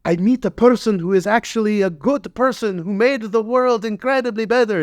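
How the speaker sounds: high wide-eyed voice of the director; childlike Werner Herzog. Retold or imitated. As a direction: gush like a starstruck kid. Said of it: "he's like a little kid".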